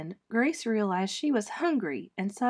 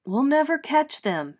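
frustrated; 6 semitones higher, 5 dB louder than usual. Acted. Frustrated steward, chase lost.

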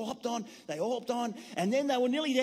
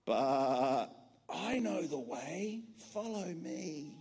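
low, slow, gentle little lamb; small lamb speaking slow and low; 7 semitones lower, 4 dB quieter, much slower than usual. Acted. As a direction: calmly offer the way. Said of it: "heard a little voice".

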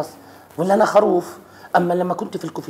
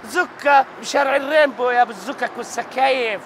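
angry yell; 6 semitones higher, 3 dB louder than usual. Acted. Husband insults valet in quarrel.

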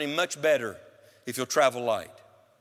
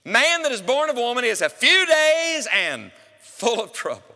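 scripture recital; 7 semitones higher, 5 dB louder than usual. Acted. Preacher quoting scripture.

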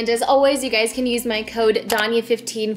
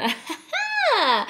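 high squealing laugh; giggling at herself; 12 semitones higher than usual. Real, not acted.

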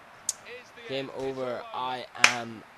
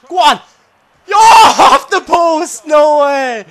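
high, loud, drawn-out yell; real agonised outburst; disbelieving shout; 11 semitones higher, 14 dB louder, slower than usual. Real, not acted. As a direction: scream as the shot hits the post. Shot hits the post.

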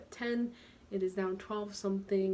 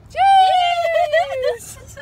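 loud exclamation; 15 dB louder than usual. Real, not acted. Ugly laugh reaction.

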